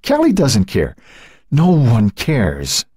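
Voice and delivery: anguished, choked-up accusation; tearful, despairing outburst; strangled by a sob. A narrator's reading. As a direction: climb in pitch, then drag out the last words in despair. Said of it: "strangled by a sob".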